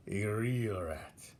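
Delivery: put-on slow, drawn-out old rat; slow sly verdict. Old rat names young rat a real rat, slow and sly. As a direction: savour the last words.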